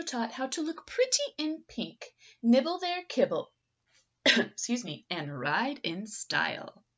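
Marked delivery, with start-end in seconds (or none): none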